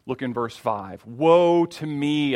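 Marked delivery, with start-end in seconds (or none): 1.0-2.3 slow, louder cry of woe; cried out. Acted. Isaiah cries out before God's glory, a slow loud lament. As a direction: let the woe ring out, drawn long. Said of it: "I cried".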